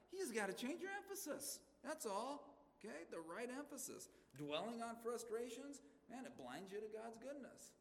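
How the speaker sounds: high, pressing plea; urgent exhortation. Real, not acted. Preacher urging the congregation to change their emphasis, with high-pitched urgency. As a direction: lift it high and urge them.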